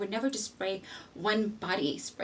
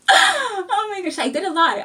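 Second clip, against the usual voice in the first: cracking up at her own fib; laughing disbelief; 11 semitones higher, 10 dB louder than usual. Real, not acted. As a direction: howl with laughter at yourself. Laughing at herself.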